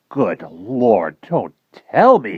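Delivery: slow, dawning astonished exclaim. Acted. Professor realizing who the admiral is, astonished. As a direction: realize it all at once. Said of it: "he exclaimed".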